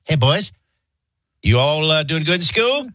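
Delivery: lightly put-on neighbor checking on kids; a touch of role-model act; imitating Kenny. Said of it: "That's how he talked".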